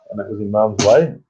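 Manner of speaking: ordinary talk, nothing marked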